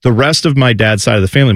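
ordinary talk

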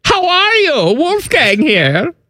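high-pitched mock German; hammed-up German character voice; German accent. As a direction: introduce yourself in a drawn-out German accent. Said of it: "This is my German accent".

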